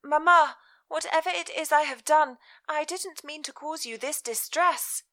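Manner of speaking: put-on high, startled young woman; bewildered daughter. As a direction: ask in alarmed confusion. Elizabeth's voice in story.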